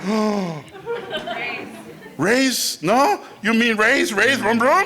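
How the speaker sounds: high, rising incredulous questions; mock disbelief. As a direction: squeak up in dawning confusion. Acts out realizing it means racing.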